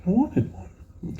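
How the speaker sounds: high, slow mock teen reply; sheepish teen. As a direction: play his younger self, small and high. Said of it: "I'm like".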